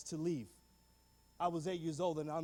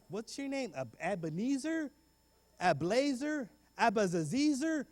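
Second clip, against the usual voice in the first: mocking kids; 5 semitones higher, slower than usual. Kids mangle his name.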